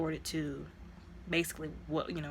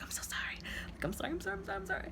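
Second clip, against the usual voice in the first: begging sorry; 14 semitones higher, 8 dB quieter, much faster than usual. Retold or imitated. Taskmaster begging forgiveness retold.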